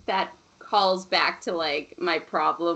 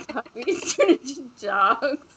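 slow, higher, breaking into laughter; laughing mock quote; 4 semitones higher than usual. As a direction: act out the stranger while cracking up. Imagined stranger's quote, laughing through it.

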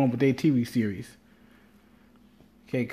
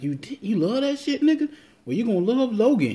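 high-pitched, hyped-up disbelief; excited retold exclamation; 10 semitones higher than usual. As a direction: squeal it quickly, as if amazed at Dad. Retells exclaiming to his dad.

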